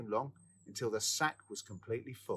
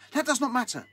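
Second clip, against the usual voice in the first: firm retort; 12 semitones higher, 7 dB louder than usual. A narrator's reading. Sexton overrides sick friend.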